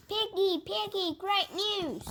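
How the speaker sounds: high eager calling out; excited. Acted. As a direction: call out with happy news.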